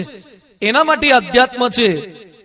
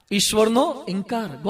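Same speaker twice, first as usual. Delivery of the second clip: "ઈશ્વરનો", pause, "ઇનકાર", softer; drawn-out, quiet "ઈશ્વરનો ઇનકાર"; slow repeat; 6 dB quieter, slower than usual. Shown as a demonstration. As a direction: repeat the title slowly and more softly. Title repeated slowly for effect.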